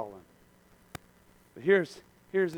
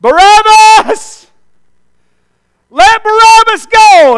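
high, loud, drawn-out mob shout; stretched-out bellowed chant; screaming crowd; 23 dB louder, much slower than usual. Acted. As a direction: scream it like a crowd demanding a release. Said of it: "the crowd starts screaming out".

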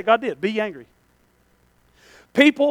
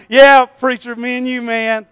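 mock congregation; 2 semitones higher, 6 dB louder, slower than usual. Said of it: "All y'all are like".

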